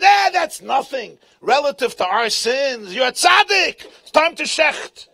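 high, loud, slow brushing it off; loud mock dismissal. Shmuel brushes off the sins, loud and slow. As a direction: wave it away and move in.

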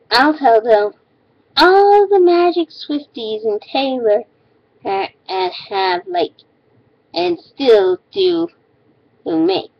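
high ditzy mocking read; mocking girly voice. Reading hater's comment mockingly.

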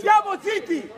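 high, loud command; shouted order. Real, not acted. Player orders teammates to stop talking, shouting. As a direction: shout down the arguing.

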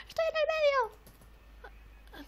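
very high, drawn-out squeal; slow falsetto wail. Real, not acted. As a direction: stretch it out way up high. Squealing at landing in the middle.

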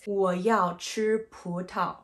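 ordinary talk, nothing marked

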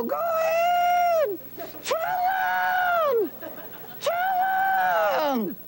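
high shrill yelling dummy; shouting. Acted. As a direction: yell the order at your partner.

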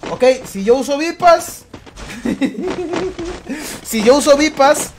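slow, laughing through it; amused, drawn-out explanation. Real, not acted. Laughing while explaining the ban.

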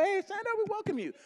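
high-pitched, playful call to stand up; retold welcome. Retold cheery welcome to witches.

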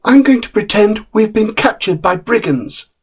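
put-on a tired little boy cheering himself up with a story; young boy Peter.

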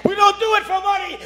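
high, loud shout on don't. Real, not acted. Preacher to his congregation about money, high ringing shout. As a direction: proclaim it to the whole church. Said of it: "I'm in love with God".

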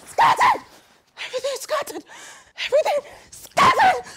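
high, loud cries of distress; agitated, high-pitched repetitive shouting; distressed outcry. Acted. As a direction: cry it out in rising panic. Distressed fixation on a messy room.

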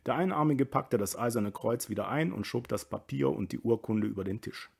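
ordinary delivery

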